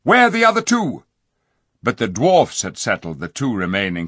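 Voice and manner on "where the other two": high urgent shout across the fight. A narrator's reading. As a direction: call out loud and high. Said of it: "shouted to Eustace".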